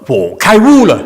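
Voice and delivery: high, loud mock American accent; American-accented Chinese. Teacher mimicking an American student's Chinese, loud American accent. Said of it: "That's how you American would say".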